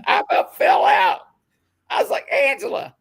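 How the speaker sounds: very high, squealing laughed words; shrieky words between real laughs; helpless laughter. Real, not acted. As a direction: retell it while falling apart laughing. Cracking up recalling own reaction.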